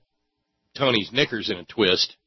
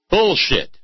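loud, high scoffing outburst; angry outburst; 9 semitones higher, 5 dB louder than usual. Real, not acted. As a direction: spit out the word in disgust. Outburst rejecting the claim.

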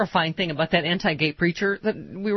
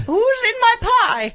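very high, slow wailing cry; shrieking old aunt; 5 dB louder, much slower than usual. Pretend. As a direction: wail it out slowly. Aunt Bee parody.

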